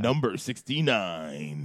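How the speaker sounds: low, drawn-out countdown announcer; slow, low list-show announcer; mock announcer. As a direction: announce it slowly in a deep voice. Announces list numbers in deep voice.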